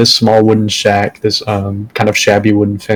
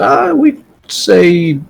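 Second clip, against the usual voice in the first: put-on slow, high old woman drawl; slow old lady; 6 semitones higher, much slower than usual. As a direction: take your time like an old shopkeeper. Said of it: "She said".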